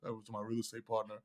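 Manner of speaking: sheepish quiet admission; quiet head down. Retold or imitated. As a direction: mumble it low and dejected. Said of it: "put his head down".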